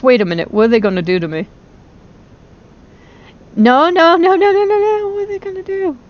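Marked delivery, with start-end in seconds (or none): 3.5-6.0 panicked pleading. Real, not acted. Panicking at new attack.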